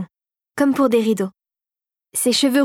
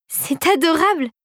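higher, delighted exclamation; delighted cry; 7 semitones higher than usual. Acted. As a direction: burst out in delight at the gift. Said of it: "s'écria-t-elle".

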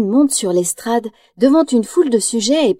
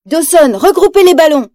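higher, louder urgent order; urgent cry; 6 semitones higher, 4 dB louder than usual. Acted. Basil shouting orders to Dawson, urgent cry. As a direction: call out to your partner in a hurry. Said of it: "s'écrie Basil".

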